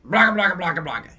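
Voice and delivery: loud, high monster babble; monster gibberish. Acted. The elder-god monsters booming gibberish up at Zeus. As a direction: roar nonsense like a big dumb beast.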